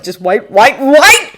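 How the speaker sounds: building to a loud, high last word; rising, frantic repetition; mounting panic. A narrator's reading. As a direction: let panic climb word by word. Mind blanking in panic.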